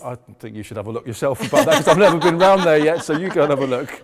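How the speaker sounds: giggling through the words; laughing. Real, not acted. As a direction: talk while laughing hard. Joking about lion's back end.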